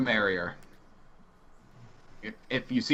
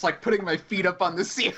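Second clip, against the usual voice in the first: higher, breaking into a real laugh; laughing while talking; 6 semitones higher than usual. Real, not acted. Player picturing feet on the ceiling, helpless laughing. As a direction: crack up as the words come out. Cracking up at own mental picture.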